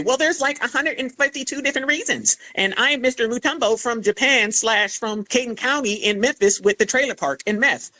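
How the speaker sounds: high-pitched mocking imitation of a naysayer. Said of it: "the ones always trying to say".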